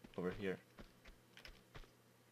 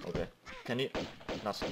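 normal talking voice

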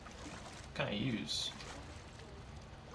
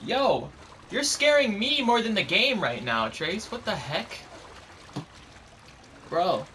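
loud spooked outburst; scream into giggles; 7 semitones higher, 5 dB louder, slower than usual. Real, not acted. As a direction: yell half laughing, still rattled. Scared by character's sudden look.

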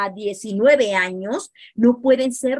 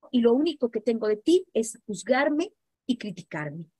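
normal talking voice

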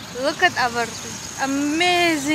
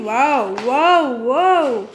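amazed wow; 5 dB louder, much slower than usual. Real, not acted. Admiring new shoes.

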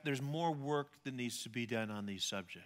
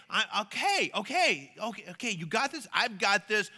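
mock panic; 5 semitones higher, 7 dB louder than usual. Imagines himself panicking in boat.

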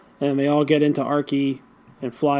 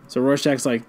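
usual speaking voice

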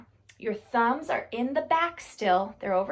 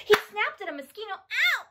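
high, quick burst into a yelp; playful snapping; 7 semitones higher, faster than usual. Acted. Telling children the turtle snapped at a mosquito, playful snapping. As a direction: build fast to a high "Ow". Turtle snaps in action rhyme.

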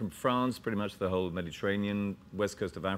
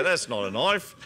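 put-on Australian accent; 3 semitones higher, 5 dB louder than usual. Echoes the Australian film line.

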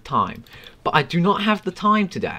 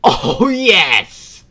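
higher, louder cheer; excited; 6 semitones higher, 8 dB louder than usual. Real, not acted. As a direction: shout it with joy. Cheering a goal.

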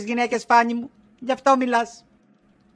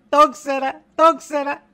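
tearful; 3 semitones higher, 4 dB louder, slower than usual. Acted. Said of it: "Τώρα γιατί κλαις".

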